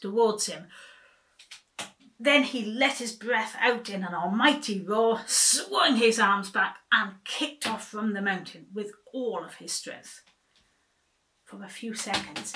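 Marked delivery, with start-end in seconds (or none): 2.2-10.2 excited. A narrator's reading. Narrating the almighty roar.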